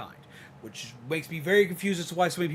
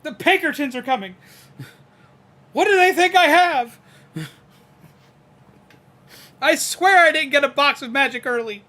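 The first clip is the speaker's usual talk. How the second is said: panicking; 12 semitones higher, 13 dB louder than usual. Pretend. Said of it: "I would panic".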